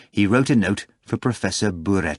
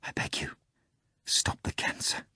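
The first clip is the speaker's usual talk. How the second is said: breathy unvoiced whisper plea; 11 dB quieter than usual. A narrator's reading. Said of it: "the bartender whispered".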